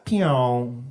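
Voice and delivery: low, drawn-out "pew"; lazy laser sound. Retold or imitated. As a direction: sag down like a laser that can't be bothered. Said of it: "that's the sound of a lazy laser".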